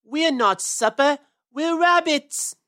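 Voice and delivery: slow, high indignant yell. Acted. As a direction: yell it like an upset kid. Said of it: "yelled Peter".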